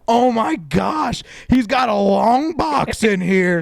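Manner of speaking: high, slow, drawn-out astonished cry; amazed shout. Retold or imitated. Trail guy seeing the long-bed truck emerge, astonished slow shout. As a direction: gawk at the truck out loud. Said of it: "that guy's like".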